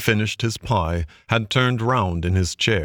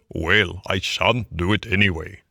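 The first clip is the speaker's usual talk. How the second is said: ordinary delivery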